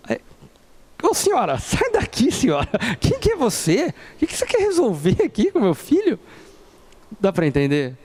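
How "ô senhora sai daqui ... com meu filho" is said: high, mocking boss voice; annoyed boss. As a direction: brush off the meddling mom. Boss shooing away the mother.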